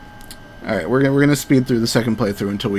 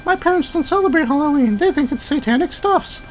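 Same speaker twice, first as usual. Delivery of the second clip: put-on shrill girl voice. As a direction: squeak it out like a giddy girl. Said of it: "these voices".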